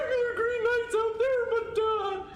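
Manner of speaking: high-pitched mock voice; mocking developers. Gamer imitates developers excusing a missing Green Knight, mocking. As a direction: put on a high, silly voice to mock them. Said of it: "if they'd be like".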